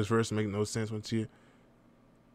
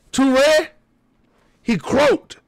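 high, loud mock verdict; loud punchline; 11 semitones higher, 8 dB louder, much slower than usual. Real, not acted. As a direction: call it out for the laugh.

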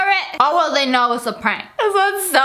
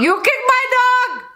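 mock angry neighbour; 11 semitones higher than usual. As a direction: parrot the prank voice to tease. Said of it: "that shut up was so perfect".

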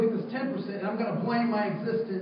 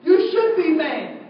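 emphatic retort; 6 semitones higher, 8 dB louder, slower than usual. Retold or imitated. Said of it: "guess what I said to him".

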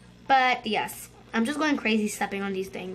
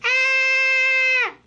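high, loud, shrill scream; 12 semitones higher, 6 dB louder than usual. Real, not acted. Kid caught in the crusher, screaming. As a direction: scream as the trap hits.

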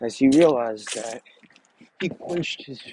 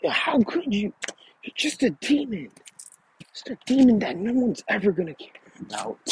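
enraged yelling; 10 semitones higher than usual. A narrator's reading. Sakura lashing out at Naruto over Sasuke, high angry yell. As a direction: voice Sakura's outrage in a much higher register. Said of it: "yelling at him".

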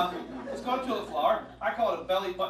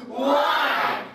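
loud, high countdown cheer; excited cheering; 8 semitones higher, 8 dB louder, much slower than usual. Real, not acted. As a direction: cheer him on at full volume. Cheering on the puppet's trick.

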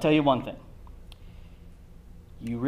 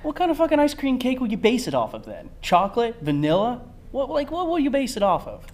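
exasperated; 11 semitones higher, 4 dB louder than usual. Acted. Comic argument, high and loud.

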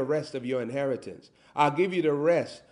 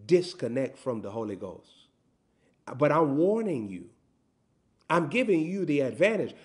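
normal talking voice

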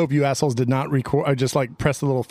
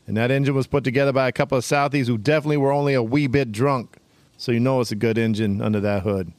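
put-on Boston accent. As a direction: fake Boston car-ad pitch. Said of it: "Irish mixed in with the Boston accent".

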